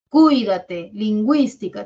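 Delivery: teacher calling a caution to students; loud warning. Real, not acted. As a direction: warn the class sharply. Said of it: "warning to students".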